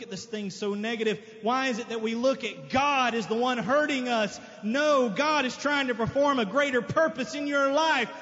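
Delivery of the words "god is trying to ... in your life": ordinary delivery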